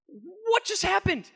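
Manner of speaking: a driver horrified at the trapped dog; stunned panic. Retold or imitated. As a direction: ask in disbelief and fear. Seeing the dog under the tire.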